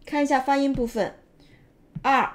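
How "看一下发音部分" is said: ordinary delivery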